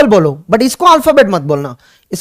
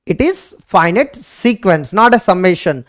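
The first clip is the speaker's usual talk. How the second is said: very slow, word by word, quieter; slow dictation; 4 semitones lower, 4 dB quieter, much slower than usual. Shown as a demonstration. Teacher to students, dictating the definition slowly. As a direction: spell the definition out slowly for notes.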